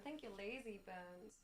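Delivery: usual speaking voice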